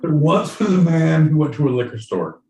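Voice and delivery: loud, declaimed verse; hammed-up poem delivery; improvised limerick recital. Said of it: "How are you feeling using a limerick?".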